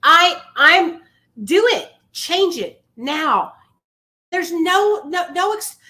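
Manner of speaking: high, loud urging; rally them, full force; booming motivational speech. Real, not acted. Pumping up a struggling listener.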